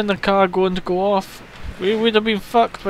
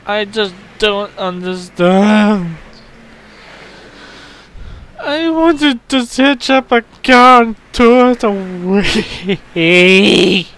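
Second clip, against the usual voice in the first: slow, loud exasperated complaint, higher by the end; 3 semitones higher, 9 dB louder, much slower than usual. Real, not acted. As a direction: drag the words out in loud disbelief. Exasperated at failing hitch.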